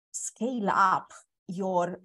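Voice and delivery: ordinary talk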